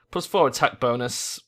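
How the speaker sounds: usual speaking voice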